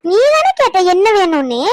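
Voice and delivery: high, loud sibling protest; indignant yelling. Acted. Little sister shouts back at her teasing brother, indignant yell. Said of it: "கத்தாத".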